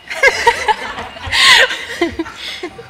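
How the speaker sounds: high cackle breaking character; high breaking laugh. Real, not acted. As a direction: burst out laughing mid-line.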